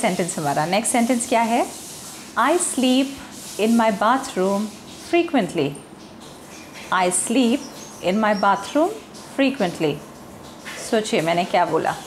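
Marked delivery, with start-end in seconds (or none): 2.4-5.7 "sleep" with a long ee vowel; deliberate wrong long vowel in "sleep"; mispronounced slip as sleep. Shown as a demonstration. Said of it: "स्लिप और स्लीप में ज़्यादा फ़र्क नहीं है". 6.9-9.9 "sleep" with a long ee vowel; deliberate wrong long vowel in "sleep"; mispronounced slip as sleep. Shown as a demonstration. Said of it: "स्लिप और स्लीप में ज़्यादा फ़र्क नहीं है".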